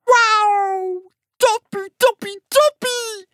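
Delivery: very high, elated frog voice; excited frog cry. A narrator's reading. Frog cheering the hopping trick, excited cry. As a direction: squeal it out as an overjoyed frog. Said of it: "riep de kikker".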